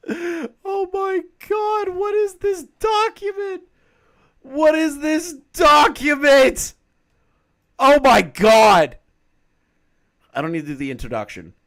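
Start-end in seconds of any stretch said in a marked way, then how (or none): 0.1-9.0 very high, slow, stretched-out disbelieving shriek; shocked shriek. Real, not acted. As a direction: scream it out in stunned disbelief. Shocked at document.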